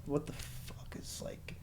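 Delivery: exasperated whisper to himself. Retold or imitated. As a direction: breathe it out in disbelief under his breath. Exasperated reaction to psychologist.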